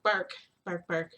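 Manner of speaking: playful mock barking; imitating dog barks. Vlogger copying the neighbours' new puppy, playful mock barks. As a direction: bark like the puppy downstairs. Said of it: "You hear the doggy? It's like".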